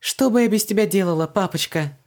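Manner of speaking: high, quick, delighted thanks; grateful. A narrator's reading. Daughter thanks her father while dashing out, grateful. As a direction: gush gratefully to your dad. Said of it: "Благодарно улыбнулась Вон".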